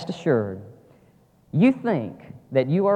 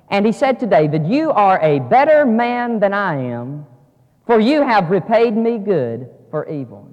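raised, loud declamation of a verse; emphatic scripture reading; 6 semitones higher, 8 dB louder than usual. A narrator's reading. Preacher reading Saul's words to David, loud and deliberate. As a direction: proclaim it slowly to the congregation.

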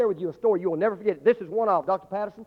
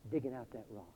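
tender father; 8 semitones lower, 15 dB quieter than usual. Acted. Father's quiet question to son.

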